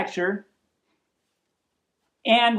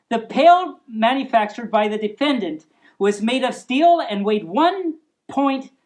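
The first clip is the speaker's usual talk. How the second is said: usual speaking voice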